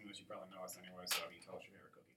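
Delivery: secret whisper to the listener. Acted. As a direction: drop to an unvoiced whisper. Conspiratorial aside to listeners.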